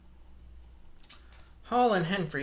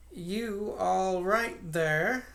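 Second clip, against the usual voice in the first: slow, higher, drawn-out sharp question; 4 semitones higher, 3 dB louder, much slower than usual. Acted. Innkeeper asking sharply through the door. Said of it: "asked Mister Hall sharply again".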